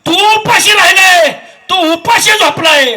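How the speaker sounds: forceful declamation climbing to high, loud cries; impassioned preaching. Acted. Preacher telling how the father went hungry, impassioned. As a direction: build the praise up to a shout.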